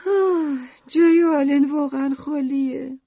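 high, wistful character voice; sighing. A narrator's reading. Lucrece misses Alan while he is away, sighing. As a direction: sigh first, then lament in high pitch. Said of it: "لوکریس آهی کشید".

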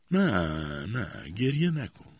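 slow, drawn-out hushing plea; consoling. A narrator's reading. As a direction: soothe her out of crying. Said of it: "عمه او را دلداری داد".

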